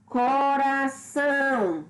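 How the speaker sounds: "Coração" very slow, with ÇÃO stressed; stress on last syllable. Shown as a demonstration. Teacher giving an example word, stress on last syllable. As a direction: stretch out "Coração", landing firmly on ÇÃO. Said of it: "é a mais forte".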